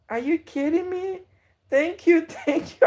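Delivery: high, loud thrilled thanks with a laugh; overjoyed thanks. Real, not acted. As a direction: gush thanks, voice jumping way up. Thanking donor, overwhelmed.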